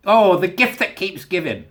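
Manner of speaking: gleeful relish. Real, not acted. Relishing another Scotland story, slow and gleeful. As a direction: savour it slowly with glee.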